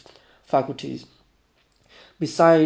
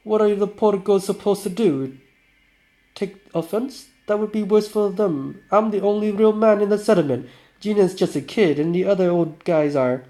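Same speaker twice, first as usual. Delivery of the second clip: lightly voiced boaster, raised pitch; loud, higher voice for a cocky drinker; boastful drinker; 6 semitones higher, 5 dB louder than usual. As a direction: play up the bragging a little.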